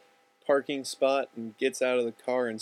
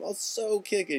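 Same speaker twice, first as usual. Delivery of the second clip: thrilled; 8 semitones higher than usual. Acted. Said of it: "It's like the best thing ever to him".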